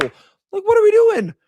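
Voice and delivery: squeaky, incredulous what are we doing; exasperated squeal. Real, not acted. Exasperated outburst at coaching.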